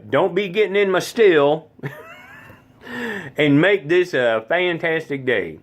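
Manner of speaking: put-on high, twangy old-granny voice with a laugh; Granny's voice. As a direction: warn them off the still like a mountain granny. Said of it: "That's what Granny used to say".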